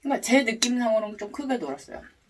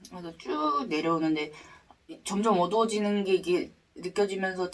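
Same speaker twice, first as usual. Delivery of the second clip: ordinary talk, nothing marked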